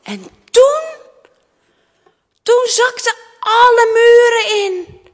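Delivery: high, loud storyteller's outcry; dramatic climax. A narrator's reading. Climax as the palace collapses. (dramatisch, luid vertellen)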